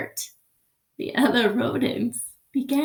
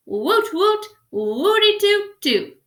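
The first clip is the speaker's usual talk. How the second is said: high, playful woot-toot cheer; silly cheer; 8 semitones higher, 4 dB louder than usual. Acted. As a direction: whoop like a happy crowd. Rodents cheering their hero.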